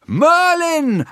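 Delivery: a high, loud shout of the name. Acted. The boy shouts for vanished Merlin, loud and high. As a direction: yell for him across the empty hill. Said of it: "I shouted".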